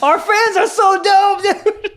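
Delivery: high-pitched, drawn-out laughing squeal; delighted laughing exclamation. Real, not acted. Delighted reaction to listener's confession.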